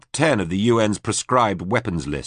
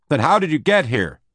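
near-shout, higher and louder; almost a shout; 8 semitones higher, 4 dB louder than usual. A narrator's reading. Rick presses the alien for answers, near-shouting. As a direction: push it nearly to a shout. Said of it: "It was almost a shout".

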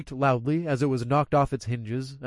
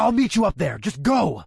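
urgent shout; 6 semitones higher, 4 dB louder than usual. Acted. Shouting back while running.

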